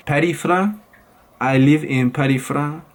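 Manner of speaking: put-on French accent. Vlogger playing someone who lives in Paris, French accent. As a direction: imitate a French accent, swallowing the end of "France". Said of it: "change my voice and do some impressions".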